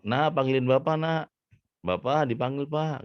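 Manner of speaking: high-pitched calling across the house; mother calling out. Acted. Mother has the child fetch father, high calling voice. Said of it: "Kemudian ibu ini bilang".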